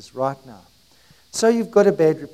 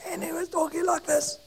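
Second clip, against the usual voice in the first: tiny, high, soft imitation of a lost voice; laryngitis voice; 12 semitones higher, 8 dB quieter than usual. Preacher imitates a helper with laryngitis, high strained voice. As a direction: squeak it out softly as if the voice is gone. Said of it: "Anthony had, uh, laryngitis".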